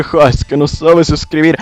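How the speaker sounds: put-on voice jeering at a bad speller; mocking sneer. Snobs laughing at those who break the rules, mocking. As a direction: imitate a snob making fun. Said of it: "pudieran burlarse de ellos y decir".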